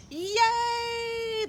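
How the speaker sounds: high excited crowd cheer. A narrator's reading. Crowd cheers as Big Bark is carried off, high cheer. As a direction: whoop with joy. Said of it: "the crowd cheered".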